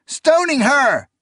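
high loud slow call. A narrator's reading. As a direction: call out across the street. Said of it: "called out".

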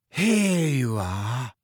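very slow, drawn-out dreamy voice; languid, faraway girl. A narrator's reading. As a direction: float the words out slowly. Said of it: "a dreamy voice".

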